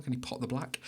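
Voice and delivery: whispered snooker commentator voice; hushed like a snooker commentator; snooker commentary. Acted. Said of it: "I've started talking like I'm a snooker commentator now".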